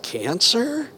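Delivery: high, soft disbelieving "Cancer?" with a rising end; quiet shocked disbelief; incredulous. Retold or imitated. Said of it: "'cause I was like".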